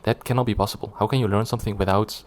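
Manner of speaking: usual speaking voice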